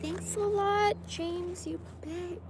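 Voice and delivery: choked up, half laughing through tears; real crying while talking. Real, not acted. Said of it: "I can read this without crying".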